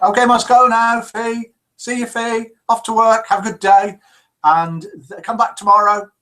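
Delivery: cheery goodbye. Retold or imitated. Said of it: "says Mackie Whack".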